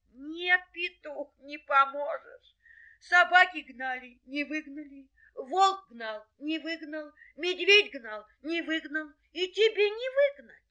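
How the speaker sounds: very high, plaintive little voice; tearful doubting hare. Acted. Hare tells the helper it is useless, tearful. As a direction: lament hopelessly in a high voice.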